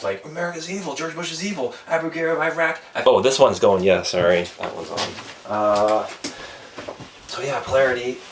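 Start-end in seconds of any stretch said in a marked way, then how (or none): 0.2-2.8 higher, softer mock-chanting voice; mock hardcore leftist. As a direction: parody your younger, far-left self reciting grievances. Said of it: "I fell into hardcore left".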